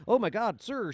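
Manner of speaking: mock bootlicking junior officer; hammed-up sycophant; fawning officers.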